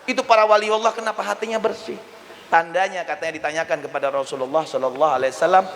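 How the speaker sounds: usual speaking voice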